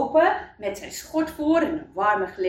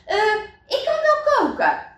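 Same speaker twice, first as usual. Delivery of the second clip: high, louder theatrical squeal; shrieked offer; 13 semitones higher, 5 dB louder than usual. A narrator's reading. Mayor's wife volunteering to cook, squealing. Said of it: "gilde de vrouw van de burgemeester".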